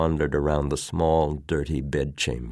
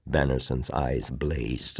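usual speaking voice

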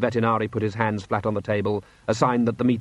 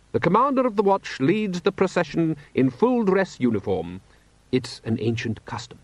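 higher-pitched, formal ruler; lofty patrician; 7 semitones higher than usual. Acted. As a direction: recite the custom as an official duty. Patrician explains ceremony.

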